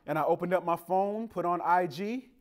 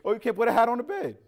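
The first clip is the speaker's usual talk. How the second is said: grandma scolding; 8 semitones higher, 7 dB louder, faster than usual. Retold or imitated. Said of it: "Did that happen to y'all".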